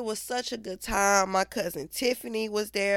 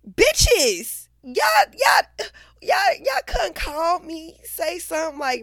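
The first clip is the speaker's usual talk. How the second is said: high, loud, tearful angry shout; crying shout; 10 semitones higher, 5 dB louder than usual. Real, not acted. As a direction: yell at friends, voice breaking with tears. Upset rant at friends.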